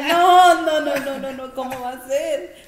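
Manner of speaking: loud, high no no no, fading into disbelief; shocked refusal. Retold or imitated. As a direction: recoil at the idea, then trail off. (negación escandalizada, fuerte y aguda, que se apaga)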